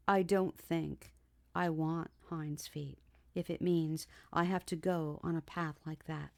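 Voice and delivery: halting, slow reluctance; slow and pained. Acted. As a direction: drag the words out with pain. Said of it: "she said slowly and painfully".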